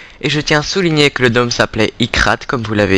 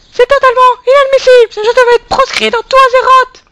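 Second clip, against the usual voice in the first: tiny squeaky outraged gnome; very high, loud, indignant squeak; 6 dB louder than usual. Acted.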